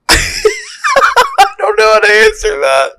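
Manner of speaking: high, slow words through laughter; laughing. Real, not acted. Laughs at the absurd question.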